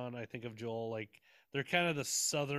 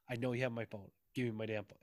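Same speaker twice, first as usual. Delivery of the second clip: low, softer imitation of stern demand; 5 semitones lower, 5 dB quieter, faster than usual. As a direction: imitate a stern friend, pitched down and contained. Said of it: "Sean in his very stern kind of voice".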